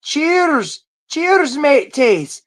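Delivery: high, loud, hammed-up pub toast; mock rowdy drinking-buddy voice; cheerful toast voice. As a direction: raise a mug and bellow it out high and slow. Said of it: "she's saying".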